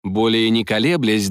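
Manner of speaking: ordinary delivery, nothing marked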